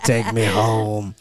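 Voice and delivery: low, very slow singer impression. Friend without his wallet, still in the slow singer voice. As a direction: drag each word out in a low voice. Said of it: "still in the voice".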